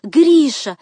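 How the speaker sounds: high, loud yell of the name; shrieked cry. A narrator's reading. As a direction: cry out as if choking with panic. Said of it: "заставлял кричать с визгом".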